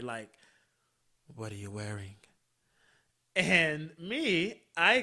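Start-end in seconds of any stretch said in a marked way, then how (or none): 1.3-2.2 low, soft imitation of his come-on; imitated phone-sex come-on. Man trying phone sex on her, imitated low and quiet. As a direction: murmur it low, as the caller. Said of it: "he's trying to have phone sex".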